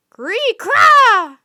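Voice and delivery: very high, very loud shrieking caw; raven croak. Acted. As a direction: screech an order like a bird king. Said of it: "he croaked to one of his bodyguards".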